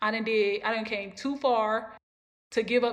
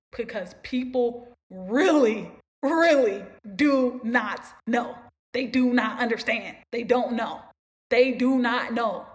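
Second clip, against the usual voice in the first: loud, slow, stressing each word; emphatic; 6 dB louder, slower than usual. Real, not acted. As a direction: hammer home that they do not know. Stressing that people don't know.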